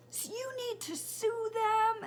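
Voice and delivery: high, loud, drawn-out demand; dramatic child imitation. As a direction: imitate an outraged kid, melodramatically. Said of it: "my son is so dramatic".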